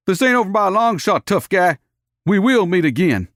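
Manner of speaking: high, loud, fast threat; angry threat. A narrator's reading. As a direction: spit the threat back. Cowboy's parting threat.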